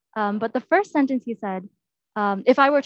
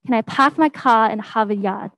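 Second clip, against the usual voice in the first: attempted Boston accent, every R dropped to ah; Bostonian accent; 4 dB louder than usual. Shown as a demonstration. Presenter imitating the man's second sentence, Bostonian accent. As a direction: say it Bostonian: pahk, cah, Hahvahd, Yahd. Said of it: "if I were to try the Bostonian accent".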